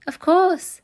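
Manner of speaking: put-on high, bright boy eagerly agreeing. A narrator's reading. Eager boy answers benefactor.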